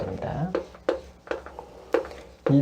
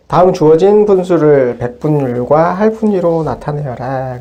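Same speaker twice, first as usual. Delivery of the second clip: louder, slow, deliberate read of the task; reading the exercise aloud; 8 dB louder than usual. Shown as a demonstration. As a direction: announce the problem clearly to the class. Teacher reads out the exercise. (문제를 크게 천천히 읽어 주듯이)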